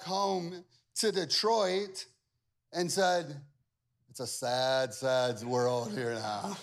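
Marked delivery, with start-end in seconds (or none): 4.1-6.6 low, slow, mock-mournful quote; friend's glum message, pitched down; imitated dejection. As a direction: read out a gloomy text, dragging it. Reads out friend's dejected text.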